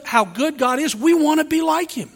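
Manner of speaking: normal talking voice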